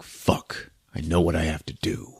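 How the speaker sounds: low, whispery mutter to himself; near-whispered resignation; hushed self-talk. Retold or imitated. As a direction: say it under your breath, low and soft. Character alone, voiced to himself.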